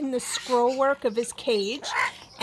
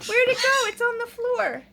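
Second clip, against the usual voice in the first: high pet voice; 9 semitones higher than usual. Real, not acted. Owner teasing her parrot, high baby-talk. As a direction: squeak it up to the bird. Playing with bird.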